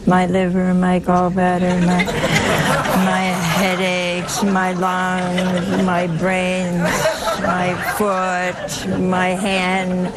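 droning monotone list of ailments; complaining organ recital. Acted. Preacher imitating Missus Joan listing her ailments, droning complaint. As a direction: recite each ailment slowly on one note, hammed-up. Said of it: "she gives you an organ recital".

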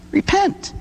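Repent said very high, then falling; emphatic Repent; stress on Repent. Real, not acted. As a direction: hit the word Repent hard. Stressing the key word.

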